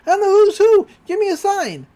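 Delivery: high, loud mock desperation; frantic exclamation. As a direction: cry out as a frantic boy, acted. Dipper desperate to tell them apart.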